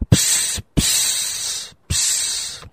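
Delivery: soft breathy psst hisses from the snake. Acted. The serpent hissing softly at the young wife. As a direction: hiss each psst like a serpent. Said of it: "siffla le serpent".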